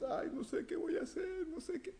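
high falsetto fretting voice; hammed-up anxious falsetto; mock worried whine. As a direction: play a panicked believer in a squeaky voice.

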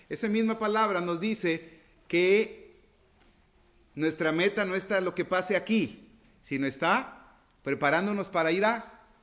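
full-voiced sermon climax; preaching at full force. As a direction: proclaim it to the congregation.

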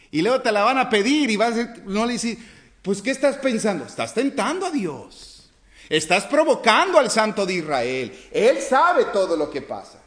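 loud, high fervent preaching; loud fiery sermon; preaching at full force. Acted. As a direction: thunder it at the congregation.

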